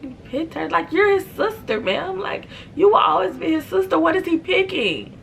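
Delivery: high, incredulous mock disbelief. Narrator reacts to the sister, high incredulous voice. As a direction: squeak it out in disbelief. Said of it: "And I'm just like".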